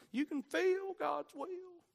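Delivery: high, slow hammed-up preacher line; mock emotional preacher. Preacher mocking emotional preaching, high and slow. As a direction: lampoon a preacher selling feelings. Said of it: "something that the preacher would".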